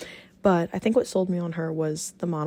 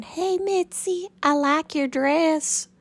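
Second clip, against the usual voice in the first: put-on high, slow, sweet old-timey farm girl accent; sing-song Pearl impression, much higher and slower; 9 semitones higher, 4 dB louder, slower than usual. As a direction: coo it slowly in a high prim Pearl accent. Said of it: "I will talk like that for hours".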